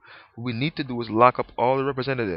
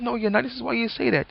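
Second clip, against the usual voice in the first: higher, lightly voiced back-and-forth; mock argument; 13 semitones higher, faster than usual. Doubter and Jesus arguing, higher mock bickering. Acted quarrel between two men.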